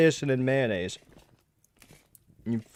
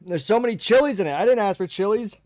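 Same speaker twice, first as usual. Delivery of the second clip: whiny complaint; 6 semitones higher, 4 dB louder than usual. Real, not acted. Complaining about chilies.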